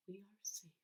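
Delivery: whispered in relief; awed whisper of relief. A narrator's reading. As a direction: breathe it out softly. Moment of rescue.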